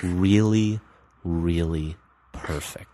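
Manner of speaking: hushed, drawn-out imitation, word by word; slow and deliberate. Imitating a strange woman praising his cologne, slow and deliberate. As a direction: let each word hang, soft and slow. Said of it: "like, very slowly and deliberately".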